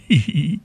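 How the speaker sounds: high, short acted giggle; cat's giggle. A narrator's reading. Cat promising to play dear Papa, high giggle.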